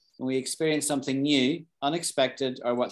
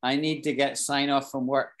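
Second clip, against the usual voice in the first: normal talking voice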